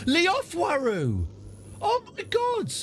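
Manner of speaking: high, drawn-out cry of disbelief; shocked surprise. Real, not acted. Player finding a beloved courtier dead, stunned cry. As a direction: gasp the name out in real shock.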